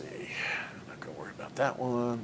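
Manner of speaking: whispered aside under breath; whispering. Real, not acted. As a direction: mutter it to yourself in a whisper. Muttered aside to himself.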